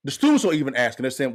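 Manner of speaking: usual speaking voice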